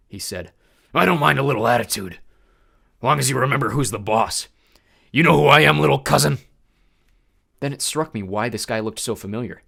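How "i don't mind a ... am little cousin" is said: loud, threatening boss voice; menacing god of war. A narrator's reading. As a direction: lean on him, remind him who rules. Ares menacing Percy.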